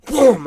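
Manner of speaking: vocal sound effect, sudden and loud; imitated impact thud. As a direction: mimic a body hitting the ground. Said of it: "It goes".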